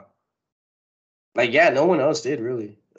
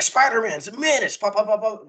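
bad country accent, pitched high; clumsy hillbilly country accent; a hint of a country-accent bootlegger; 10 semitones higher than usual. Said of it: "gave him a country accent".

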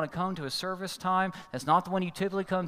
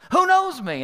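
much higher, louder snap of a question; angry demand; 13 semitones higher, 11 dB louder than usual. Retold or imitated. Driver demanding who knows him, loud and angry. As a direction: challenge him hard. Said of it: "still all mad".

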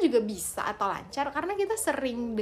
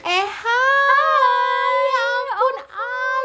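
put-on bubbly sorority girl; 12 semitones higher, 11 dB louder, much slower than usual. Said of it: "kita actingnya jago ya".

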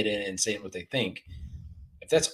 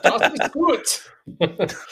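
hammed-up German accent, high and very slow, laughing through it; a mock German accent, stretched out and giggling; fake German accent; 13 semitones higher, 5 dB louder, much slower than usual. Fooling the waitress he's from Germany, hammed-up German accent. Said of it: "like, a fake German accent".

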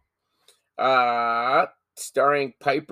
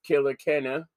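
usual speaking voice

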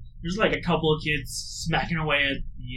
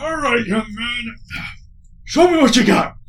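put-on old trainer challenge; 8 semitones higher, 8 dB louder than usual.

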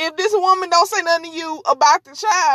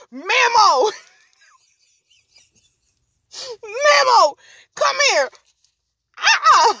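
high, insistent mock holler; calling out; 9 semitones higher than usual. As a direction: holler after him like the woman being removed. Retold woman calls after partner.

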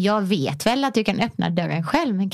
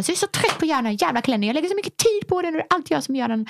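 fast, higher, exasperated griping; retold irritated outburst, rushed and higher; mock angry rant; 8 semitones higher, much faster than usual. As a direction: rattle it off like a fed-up wife. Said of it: "bara så argt, otrevligt".

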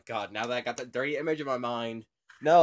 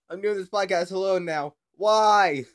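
louder, higher wail of why; mock despair; 5 semitones higher, 7 dB louder than usual. Real, not acted. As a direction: cry out at being left alone. Cohost hung up, dismay.

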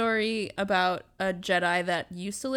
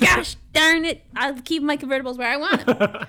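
mock exasperated shout; 5 semitones higher, 6 dB louder than usual.

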